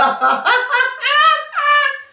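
very high, loud nasal laugh. Shown as a demonstration. Coach demonstrating her laugh, high nasal laugh. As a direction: push the laugh up into the nose. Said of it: "suis dans la voix de nez".

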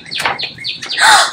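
big mock-shocked intake of breath; storyteller's suspenseful gasp; dramatic gasp. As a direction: gasp for the young listeners. Feigned shock at story surprise.

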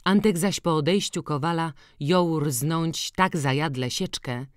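ordinary delivery

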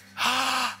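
imitated high alarmed gasp. Daughter's alarmed reaction, imitated.

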